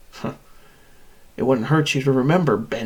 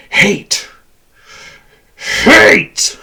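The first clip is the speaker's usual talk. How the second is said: explosive roar of loathing, the second higher and louder; shouted hatred; 6 semitones higher, 10 dB louder than usual. Acted. Machine declares its hate to captives, furious shouting. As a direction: hurl the word out twice, escalating.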